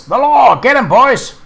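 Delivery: loud, high bandit yelling to his gang; gruff bandit shout. A narrator's reading. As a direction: bark it like an outlaw caught off guard. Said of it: "A muffled voice says".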